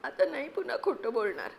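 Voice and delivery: usual speaking voice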